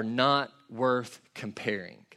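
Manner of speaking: slow, measured, stress on not; deliberate reading; slow scripture reading. Acted. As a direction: read it with weight.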